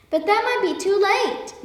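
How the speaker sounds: high, pleading cry. A narrator's reading. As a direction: protest urgently, voice up high. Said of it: "the ant cried".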